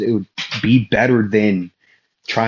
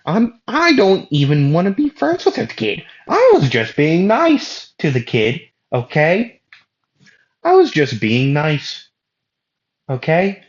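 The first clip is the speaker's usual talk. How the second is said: higher, defensive pleading; exasperated protest; 8 semitones higher than usual. Acted. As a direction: insist you were only being nice, exasperated. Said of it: "And Asta would say".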